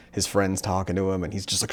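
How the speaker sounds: ordinary delivery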